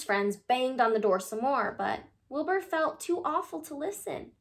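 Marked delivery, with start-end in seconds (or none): none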